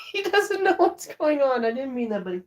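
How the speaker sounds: high-pitched pet talk, softening at the end; cooing to dog. Real, not acted. Owner apologising to their confused dog, cooing.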